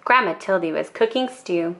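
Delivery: ordinary delivery, nothing marked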